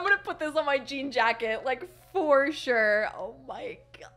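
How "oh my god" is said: soft, voiceless amazement; awed whisper. Real, not acted. Fan marvelling at new pins, awed whisper. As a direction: whisper it in disbelief.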